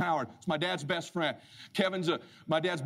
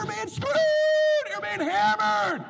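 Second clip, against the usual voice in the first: loud, high, slow outraged shout; stretched high shout; alarmed yell; 5 dB louder, much slower than usual. Retold or imitated. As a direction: drag it out in alarm. Urgent warning to Howard.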